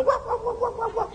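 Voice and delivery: high yapping imitation of a dog; playful high woof woof; dog barking. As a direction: bark like an excited little dog. Dog greeting owner at door.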